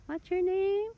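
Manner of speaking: high, loud call to the cat; high cooing call. Real, not acted. Asking the cat its name, high and loud.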